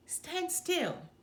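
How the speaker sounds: high, grumbling elephant voice. A narrator's reading. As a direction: grumble it impatiently. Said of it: "grumbled the elephant on the bottom".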